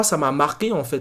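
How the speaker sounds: ordinary talk, nothing marked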